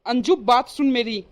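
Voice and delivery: ordinary delivery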